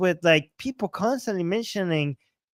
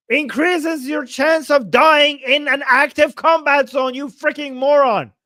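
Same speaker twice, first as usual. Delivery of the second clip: louder and higher, slowing down as the anger builds; angry outburst; 6 semitones higher, 7 dB louder than usual. Real, not acted. Reading the article, fed up, rants at the journalist angrily. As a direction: let the frustration boil over into an insult.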